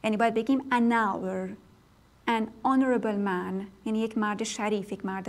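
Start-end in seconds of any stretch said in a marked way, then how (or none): 0.6-1.5 "an hour" with no H, N running into the vowel; silent H. Shown as a demonstration. Teacher shows that H is not pronounced, silent H. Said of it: "یعنی تلفظ نمیشه". 2.3-3.6 "an honorable man" slowly, no H in honorable; silent H. Shown as a demonstration. Teacher gives a slow example of unpronounced H, silent H. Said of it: "یعنی تلفظ نمیشه".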